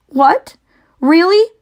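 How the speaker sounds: high, loud startled cry. Acted. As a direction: cry out in sudden hope. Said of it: "cried Wilbur".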